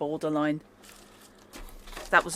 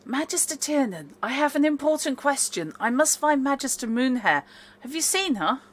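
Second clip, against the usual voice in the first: high, sweet maid voice; sweetly insistent, pitched up; 7 semitones higher than usual. Acted. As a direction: ask politely in a sweet, raised pitch. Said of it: "I will keep up my sweet voice".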